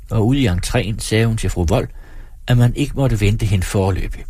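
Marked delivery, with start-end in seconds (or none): none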